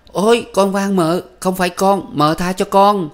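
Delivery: very high, loud pleading cry; crying out for mercy. A narrator's reading. Servant girl crying out to be spared, very high. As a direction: scream for mercy under the whip. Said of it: "Con Sen kêu".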